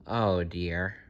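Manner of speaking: ordinary delivery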